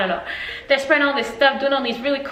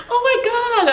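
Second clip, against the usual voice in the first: high, laughing exclamation; gasping praise, pitched way up with laughter; freaking out; 12 semitones higher than usual. Retold or imitated. Overwhelmed by the birthday surprise, laughing freak-out. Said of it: "freaking out".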